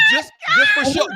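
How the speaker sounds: high loud mock shout; playful shouted jab; teasing shout. Real, not acted. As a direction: yell it out to tease her.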